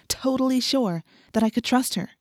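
ordinary delivery, nothing marked

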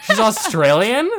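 real surprise. Real, not acted. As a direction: genuinely caught off guard, drawing it out. Learns [name] isn't British.

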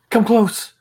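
high, loud scared call; frightened cry. Acted. DM voices woman behind door.

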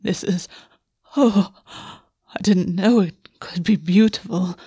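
breathy whisper, slow, voice catching; breathless wonder, words caught in the throat; catching breath. Acted. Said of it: "sounds catching in the back of his throat".

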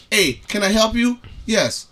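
usual speaking voice

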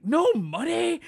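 very high, loud, incredulous yell; mock outrage. Ticket seller told kids have no money, mock outraged yell. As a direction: explode in comic disbelief. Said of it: "Instantly loses it".

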